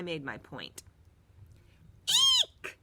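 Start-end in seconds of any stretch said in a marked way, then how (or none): 2.1-2.8 very high, loud panicked shriek; shrieking eek. A narrator's reading. Bully Marty shrieks at the mouse on his leg. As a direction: shriek in fright. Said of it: "Marty shouted".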